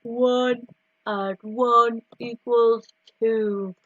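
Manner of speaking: slow, deep-set low voice. Shown as a demonstration. Teacher showing children a low voice. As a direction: drop the voice down and take it slowly. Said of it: "Now we can say it in a low voice".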